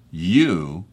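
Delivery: "You" set apart and leaned on; stress on You. Shown as a demonstration. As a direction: land firmly on "You", then pause. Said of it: "the emphasis now is on Pilate".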